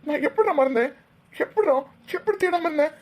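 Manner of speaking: upset, disbelieving repeats; anguished self-blame. Acted. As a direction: berate yourself in anguish. Acted guilt over forgetting to search.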